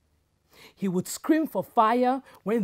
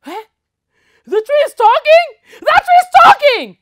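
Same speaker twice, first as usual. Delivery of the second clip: shrill, much higher panicked scream; terrified yell; 18 dB louder than usual. Acted. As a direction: scream in fright and flee. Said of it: "yelled the woman".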